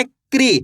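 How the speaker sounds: क्री said as one syllable, k running into ri, pitch falling; क्री with k and r joined, firmly let fall; forceful KRI sound. Shown as a demonstration. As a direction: punch out क्री as a single "kree". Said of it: "तेजी के साथ आपको इसका उच्चारण निकालना है".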